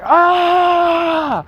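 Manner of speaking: reenacted scream of pain. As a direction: cry out as if the last kick just landed. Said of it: "I just remember screaming".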